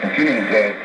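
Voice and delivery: normal talking voice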